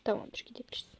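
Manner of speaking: whispered, sneaking off; sneaky whisper. Acted. Employee whispering while sneaking away from the boss. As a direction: hush it so the boss won't hear.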